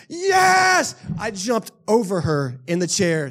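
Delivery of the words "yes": much higher and louder, all-out yell; goal scream. Retold or imitated. Fan jumping over his wife's chair, screaming. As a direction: leap over the chair yelling it. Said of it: "celebrating and screaming".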